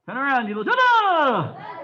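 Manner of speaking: high, loud calls with long falling tails; shouted drill commands. Real, not acted. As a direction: bellow each command, stretching the last vowel. Instructor calls out the turn.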